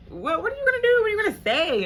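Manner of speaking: high, loud incredulous what are you gonna do; exasperated yelling. Retold or imitated. On the phone with her mom, loud exasperated yelling.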